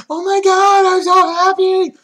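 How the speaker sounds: very high, loud gushing; exaggerated high-pitched delight, drawn out at the end; mock overjoyed squeal. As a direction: squeal it like someone hammily overcome with joy. Mocking an overjoyed retold reaction.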